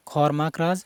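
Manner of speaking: ordinary talk, nothing marked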